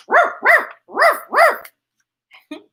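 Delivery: high yappy woofs; playful high barks for the kids; dog barking. Acted. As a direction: bark like a little dog. Voicing pets in story.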